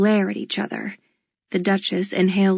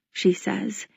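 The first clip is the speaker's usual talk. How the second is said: ordinary delivery, nothing marked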